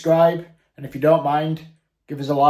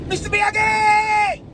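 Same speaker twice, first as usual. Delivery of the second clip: excited shout; 5 dB louder, much slower than usual. Acted. Karate Kid wax on reference.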